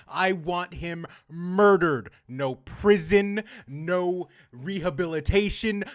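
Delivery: loud, emphatic tirade building in force; furious rant. Real, not acted. Player telling chat they want him dead, furious rant. Ranting wish for the villain's death.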